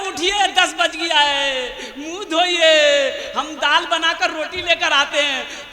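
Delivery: slow, drawn-out gentle wake-up call; wife waking husband. Acted. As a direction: wake him slowly and sweetly. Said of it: "उसकी बीवी आगे उठाई करे". (धीमी, खिंची हुई पत्नी की आवाज़)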